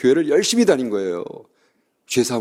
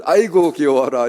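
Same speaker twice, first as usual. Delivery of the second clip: cooing; 5 semitones higher, 5 dB louder, slower than usual. Retold or imitated. Typical preacher cooing at girl.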